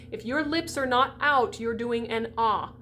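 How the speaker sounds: normal talking voice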